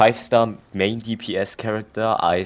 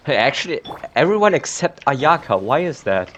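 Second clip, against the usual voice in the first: surprised outburst; 7 semitones higher, 7 dB louder than usual. Real, not acted. Noticing all characters look away.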